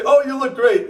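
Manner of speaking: high-pitched mock compliment; gushing friends. Friends praise his weight loss, gushing. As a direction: gush it, pitched well up.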